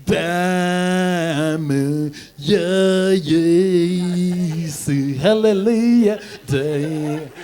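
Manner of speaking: lightly voiced drawn-out worship leader; church interjector. Church leader talking over the hymn, lightly mocked. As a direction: stretch it out like a man calling over the song. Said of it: "and they sing like that".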